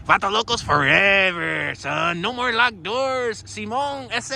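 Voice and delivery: drawn-out, hammed-up Chicano accent; mock Chicano accent. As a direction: put on a Chicano homeboy accent. Tries Chicano slang on the ducks.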